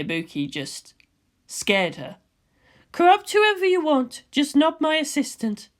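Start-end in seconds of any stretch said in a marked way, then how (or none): 2.9-5.7 higher, teasing woman's voice; a cool scientist ribbing her friend; Ritsuko's voice. Acted. Ritsuko teasing Misato at the cafeteria table, higher voice.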